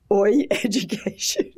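real laugh breaking into the words. Real, not acted. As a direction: crack up while announcing the title. Laughing at her joke title.

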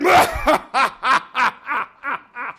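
long, loud giant's cackle; rolling staccato belly laugh; booming laugh. Acted. Sky god looks down on tiny Anansi, booming laugh.

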